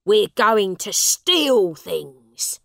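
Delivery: loud, slow, gruff order; father telling son they will steal; rough father. A narrator's reading. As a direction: growl out the plan.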